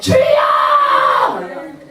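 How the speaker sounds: very high, loud, drawn-out shout; long full-voiced yell; shouted prayer cry. Shown as a demonstration. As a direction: belt out a desperate call to God. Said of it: "그냥 소리 지르세요".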